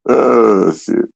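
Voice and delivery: ordinary delivery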